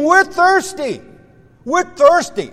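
high, loud insistent whine; complaining. Acted. Israelites grumble to God about thirst, complaining. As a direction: act out grumbling, repeated like a demand. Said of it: "they continue to complain and grumble".

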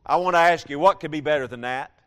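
ordinary talk

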